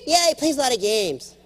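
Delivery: lightly voiced daughter. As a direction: agree like a small girl. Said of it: "daughter agreeing".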